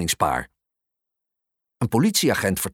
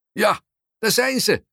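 man calling out; 6 semitones higher than usual. A narrator's reading. Man in the crowd confirming the sighting, calling out. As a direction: call it out to the crowd. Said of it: "roept de meneer".

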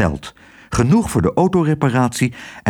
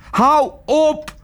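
exasperated, contained bellow. A narrator's reading. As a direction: cut them off angrily, pitch leaping up. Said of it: "bulderde Tos".

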